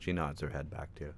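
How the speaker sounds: normal talking voice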